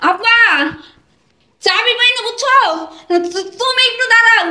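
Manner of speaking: calling out. Acted. Daughter shouts lost-key excuse to waiting father, calling out. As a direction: call the excuse through the door.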